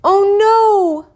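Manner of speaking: high, loud cry of dismay; mother crying out. A narrator's reading. Mother cries out on learning the teeth are gone, high and loud. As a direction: gasp it out in alarm. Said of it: "my mother cried".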